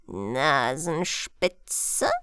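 low, very slow echo, rising at the end; questioning old one. Acted. Old stranger echoing the princess's word, questioning. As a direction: repeat it slowly, deep down, as a question.

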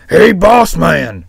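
a sick sheep's loud complaint; grumbling sick sheep. Acted. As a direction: grumble loudly down the phone to the boss. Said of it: "he grumbled".

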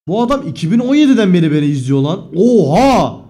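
loud, sudden oha; shocked exclamation. Real, not acted. Streamer shocked a viewer has watched since 2017. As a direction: blurt it out in real shock.

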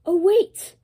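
high, loud "oh wait" of sudden memory; remembering out of nowhere, loud and high; retold sudden realization, loud and high. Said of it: "I was like".

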